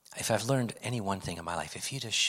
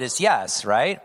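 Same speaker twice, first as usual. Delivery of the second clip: ordinary talk, nothing marked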